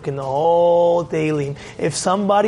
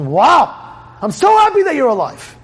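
high, glad exclamation; acted high-pitched joy; joyful relief; 7 semitones higher, 4 dB louder than usual. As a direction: cry out with happy relief. God rejoicing at the answer.

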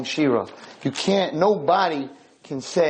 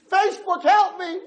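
desperate scream. Retold or imitated. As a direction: shriek at the idol to save you. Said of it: "started to scream the name".

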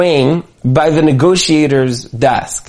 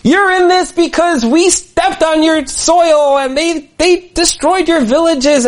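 much higher, fervent ranting; worked-up rant; 12 semitones higher than usual. Retold or imitated. FBI negotiator's words to the warlord, fervent high rant. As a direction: stoke his anger as you go. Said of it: "really getting this warlord worked up".

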